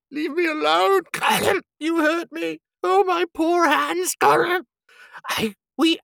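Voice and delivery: very high, loud creature wail; Gollum sobbing. A narrator's reading. Tortured creature speaking not to them, high sobbing wail. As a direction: wail it out, stretched and desperate. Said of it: "he sobbed in his throat".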